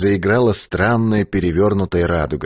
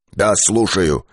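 usual speaking voice

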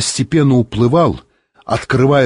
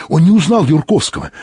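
usual speaking voice

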